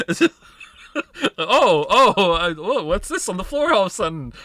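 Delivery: overplayed innocent gasps; hammed-up fake surprise. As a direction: fake surprise, laughing through it. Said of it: "acting out dropping the bracelet".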